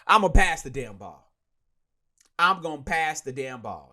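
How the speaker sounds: imitating the coach who refuses to run the ball; defiant mock coach. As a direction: mimic the coach insisting loudly. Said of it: "Kyle Shanahan, no".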